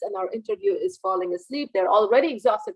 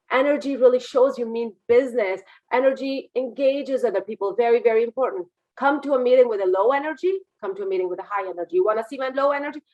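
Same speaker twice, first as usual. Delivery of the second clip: usual speaking voice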